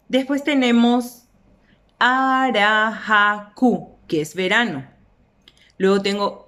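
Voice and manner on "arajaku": "arajaku" muy lento: a, ra, ja, ku; silabeado. Shown as a demonstration. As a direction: alarga cada sílaba de "arajaku". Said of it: "la silabación es sencillísima". ("arajaku" pausado, sílaba por sílaba)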